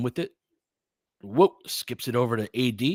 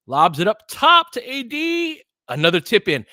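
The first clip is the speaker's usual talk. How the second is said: much higher and louder, stretched out; announcer soaring with the alley-oop; excited lob call; 10 semitones higher, 8 dB louder than usual. Real, not acted. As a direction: lift the voice with the ball on the lob.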